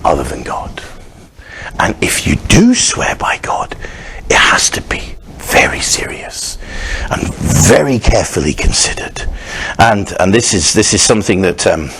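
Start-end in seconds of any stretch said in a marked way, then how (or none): 0.1-9.3 whisper. Acted. Whispered aside.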